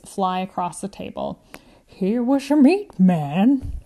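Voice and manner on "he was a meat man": put-on slow, louder old woman, fond; proud elderly widow; old lady. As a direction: savour it like an old grandmother. Grandma Jo about husband.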